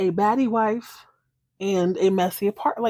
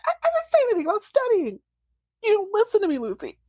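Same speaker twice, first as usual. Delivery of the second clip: high, fast, strained put-on voice for the husband; hammed-up high whine of an upset man; mock whiny husband; 12 semitones higher, faster than usual. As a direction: squeak it out like a wounded husband. Acted quote of upset husband.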